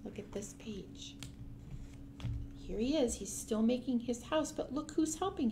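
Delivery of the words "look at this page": soft whispered aside. Real, not acted. Reader drawing children to the page in a whisper. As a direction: lean in and whisper it. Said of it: "whispered aside".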